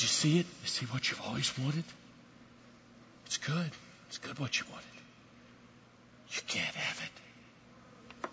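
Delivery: barely voiced, intimate whisper; very soft, fading to pure breath; divine whisper. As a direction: whisper it gently as God drawing near. Voicing God drawing near, whispered.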